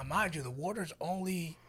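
usual speaking voice

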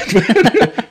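quick high chuckle; a little self-conscious laugh; short laugh. Real, not acted. Laughing at himself while telling the interviewer about the accent.

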